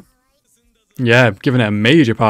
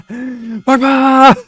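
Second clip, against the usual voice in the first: excited shout; 13 semitones higher, 6 dB louder than usual. Real, not acted. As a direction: yell the name out, high and loud. Cheering a character on screen.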